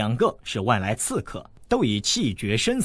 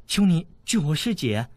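ordinary delivery, nothing marked

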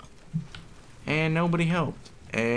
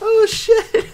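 high sudden yelp; startled yell; 7 dB louder than usual. Real, not acted. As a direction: yelp in shock. Caught by surprise.

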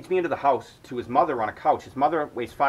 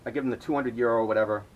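ordinary talk, nothing marked

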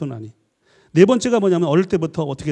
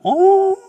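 high, drawn-out ghostly "ooh"; imitated ghost wail; 5 dB louder, much slower than usual. Lecturer mimics old TV horror ghosts, spooky wailing. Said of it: "귀신이 나오잖아".

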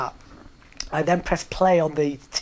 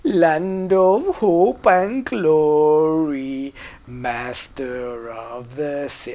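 drawn-out pompous announcer voice, imitating; slow grand announcer; 6 dB louder, much slower than usual. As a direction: stretch every word like a grand old announcer. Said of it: "a guy saying".

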